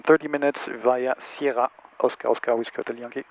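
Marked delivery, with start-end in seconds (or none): none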